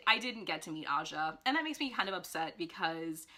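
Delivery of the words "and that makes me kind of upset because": normal talking voice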